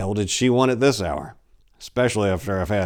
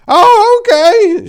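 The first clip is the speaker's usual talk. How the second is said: put-on very high, loud old-lady falsetto; old lady Pickles; 17 dB louder, much slower than usual. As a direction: squeal it out like a frantic old woman.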